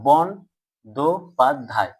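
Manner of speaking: "বন, দো, পাদ, ধাই" set apart; syllable by syllable. Shown as a demonstration. Teacher looking for open syllables, "বন, দো, পাদ, ধাই" beat by beat. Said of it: "দল বিশ্লেষণ করে কি কি পেলাম".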